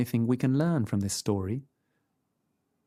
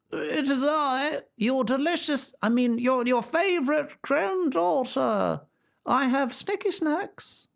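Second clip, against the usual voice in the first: much higher, slow fake little voice; wolf's little voice; 14 semitones higher, slower than usual. A narrator's reading. Wolf at the door faking the granddaughter, high and slow. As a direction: squeak it, faking a small girl. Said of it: "did his best little voice".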